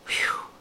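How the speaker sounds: soft, breathy whew; relieved sigh. A narrator's reading. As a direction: let out a whispered whew. Girl relieved after all the shouting.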